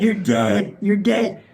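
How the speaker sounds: high-pitched mock taunt; childish gloating. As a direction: crow over a beaten enemy. Gloating over dead fire ants.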